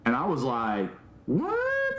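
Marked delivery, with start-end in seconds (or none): 1.3-2.0 very high squealed "what"; acted shock, pitch shooting way up; freaked-out disbelief. Retelling his reaction to surprise money, freaked-out high squeal. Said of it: "I, like, freaked out".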